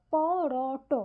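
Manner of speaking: পরট broken into slow, separate pieces, pô-rô-ṭô; teacher's drawn-out breakdown of পরট; syllable by syllable. Shown as a demonstration. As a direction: sound out পরট as pô, rô, ṭô with each vowel held. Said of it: "ভেঙে দেখানোর চেষ্টা করেছি".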